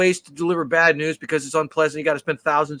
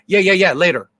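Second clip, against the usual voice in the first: rude dismissive brush-off; 2 semitones higher, 7 dB louder than usual. Retold or imitated. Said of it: "how rude and dismissive that was".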